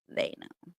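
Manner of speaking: whispered echo. Real, not acted. Echoing to the viewers that the angels know, whispered. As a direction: breathe it again as a secret.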